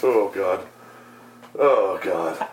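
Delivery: usual speaking voice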